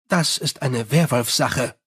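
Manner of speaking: plain reading voice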